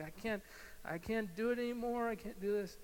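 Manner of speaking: high-pitched, half-acted crying plea; acted tearful despair. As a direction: plead as if giving up in tears. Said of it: "you start crying".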